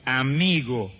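gentle low Amigo spoken with love; loving. Retold or imitated. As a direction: say Amigo full of love for the betrayer. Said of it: "fíjese el amor que había en el Señor".

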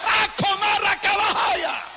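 high, full-throated ecstatic outburst; ecstatic preacher's shout. Real, not acted. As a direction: cry out in fervent tongues. Ecstatic outburst at sermon's climax.

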